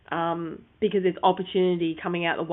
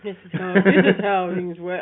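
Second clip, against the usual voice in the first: grandfather voice; 4 dB louder than usual. Acted. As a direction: lecture like a stubborn grandpa. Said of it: "this is my grandfather voice".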